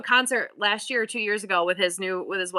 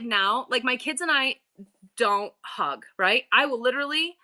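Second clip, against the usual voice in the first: ordinary delivery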